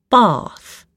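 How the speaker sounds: "Bath" with a long "ah" vowel. Shown as a demonstration. Reader teaching the Queen's English, "Bath" with long A. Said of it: "make the A sound longer".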